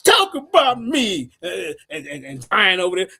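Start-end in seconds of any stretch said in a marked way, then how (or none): 0.0-1.3 high, loud, drawn-out mock grievance; mock complaint. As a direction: imitate a complainer, slow and loud. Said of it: "That's what they do".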